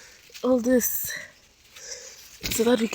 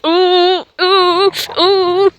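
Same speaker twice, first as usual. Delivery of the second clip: excited ooh; 9 semitones higher, 6 dB louder, much slower than usual. Real, not acted. Admiring her greens.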